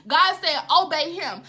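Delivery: loud, urgent prophetic preaching; full-force preaching. Real, not acted. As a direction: drive each command home to the congregation.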